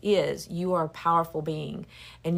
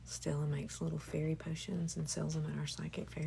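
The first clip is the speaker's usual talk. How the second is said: ordinary delivery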